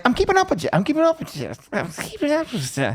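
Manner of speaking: put-on high-pitched drunk slur, fading out; drunken slurring. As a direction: imitate a drunk insisting, words running together. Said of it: "Sir, you're slurring".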